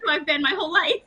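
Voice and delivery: high-pitched, flustered protest; mock existential crisis. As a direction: act out a comic meltdown, torn and indignant. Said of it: "having an existential crisis going".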